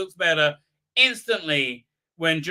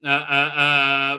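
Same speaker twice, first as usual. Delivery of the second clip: ordinary talk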